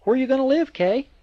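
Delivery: high, loud, squealing question; girl squealing on the phone at her friend's news; delighted squeal. Acted. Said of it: "Echo squealed in delight".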